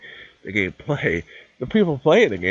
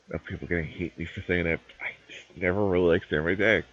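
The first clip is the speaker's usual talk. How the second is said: ordinary talk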